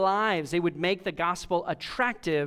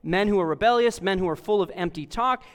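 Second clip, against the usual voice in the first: ordinary talk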